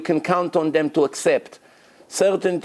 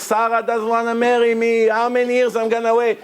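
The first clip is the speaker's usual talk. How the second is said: mock pleading scream; 7 semitones higher than usual. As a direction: cry it out like a desperate bachelor in shul. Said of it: "everyone scream like this".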